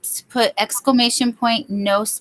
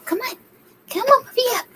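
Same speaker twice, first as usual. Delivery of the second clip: very high coaxing dog voice; dog talk; faster than usual. Real, not acted. Owner coaxing her dog up onto the bed. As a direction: coax the pup up in a high sing-song.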